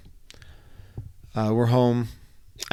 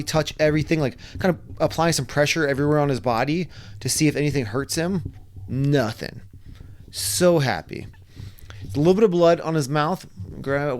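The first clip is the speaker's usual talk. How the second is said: usual speaking voice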